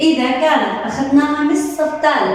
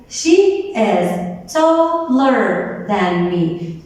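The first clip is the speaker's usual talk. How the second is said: slow example sentence; 2 semitones lower, much slower than usual. Shown as a demonstration. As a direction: model sentence spoken very slowly. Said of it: "Look at the example".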